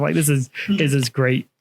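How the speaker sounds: usual speaking voice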